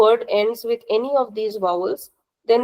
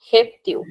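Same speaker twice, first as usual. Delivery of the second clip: "Heptiu" with the first syllable, Hep, stressed and extended. Shown as a demonstration. Teacher says "Heptiu" so students hear the stress, Hep stressed. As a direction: stress and stretch the Hep in "Heptiu", letting tiu fall. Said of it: "Which part seems extended to you?".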